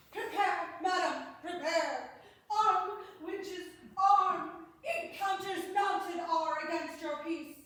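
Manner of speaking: loud, high urgent call to arms; loud alarm. Acted. Courtier warns the ladies their suitors approach, loud urgent call.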